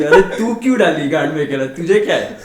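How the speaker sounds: doctor yelling at the wife, half-laughing. Retold or imitated. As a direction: yell at the wife but laugh. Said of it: "मैं बीवी को चिल्लाया".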